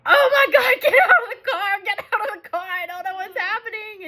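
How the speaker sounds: high, loud, panicky cry of alarm; panicked mom. Retold or imitated. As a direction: shriek it like smoke is pouring from the car. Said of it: "I'm like".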